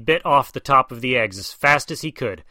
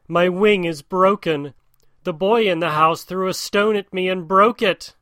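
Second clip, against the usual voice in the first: raised, higher cry of a bird faking a broken wing; crying out in pretend pain; 7 semitones higher than usual. Bird's wife fakes injury to lure the cobra, higher mock cry. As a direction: call out higher, playing wounded. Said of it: "and cried".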